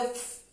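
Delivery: "F" as a sustained fff, not the letter name; sonido F. Shown as a demonstration. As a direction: blow out a long voiceless fff for "F". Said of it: "el sonido F". ("F" como un soplo largo: fff)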